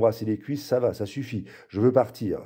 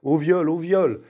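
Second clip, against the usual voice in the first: high-pitched repeated cry for help; raised, insistent yell; bawling child; 6 semitones higher, 3 dB louder, slower than usual. A narrator's reading. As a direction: bawl it out as an accusation. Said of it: "Elle braille".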